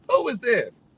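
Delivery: mock-snap a wary who-is-this; suspicious reply. The shoeshiner texting back, lightly mocked suspicious. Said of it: "This is his response".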